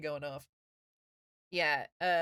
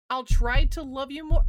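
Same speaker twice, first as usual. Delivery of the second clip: loud playful promise; 5 semitones higher, 9 dB louder than usual. Real, not acted. Playful banter with chat.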